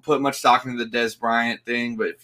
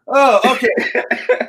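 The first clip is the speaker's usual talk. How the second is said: embarrassed laugh; 10 semitones higher, 5 dB louder, much slower than usual. Real, not acted.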